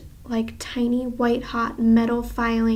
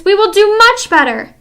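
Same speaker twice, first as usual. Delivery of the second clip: higher, louder promising voice; eager witches; 9 semitones higher, 9 dB louder than usual. Acted. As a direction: promise eagerly to please her. Audience witches pleading.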